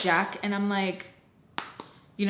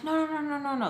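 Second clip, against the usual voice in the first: high string of mock denials; pitched-up protesting, imitated; imitated bullshitting denial; 7 semitones higher, faster than usual. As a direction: mimic someone talking their way out. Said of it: "how they do that".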